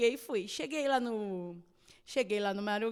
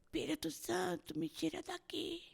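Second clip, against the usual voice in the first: imitating a desperate whisper, higher and much softer; whispered plea; 5 semitones higher, 9 dB quieter than usual. Father in intensive care begging the Holy Spirit, whispered. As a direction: breathe it out like a prayer heard faintly. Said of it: "eu só escutava assim no fundo".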